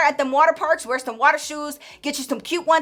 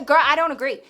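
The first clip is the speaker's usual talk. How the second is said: ordinary talk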